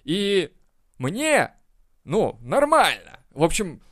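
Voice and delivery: high, slow, indifferent C-student drawl. Retold or imitated. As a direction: drag it out like a bored mediocre pupil. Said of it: "Типа".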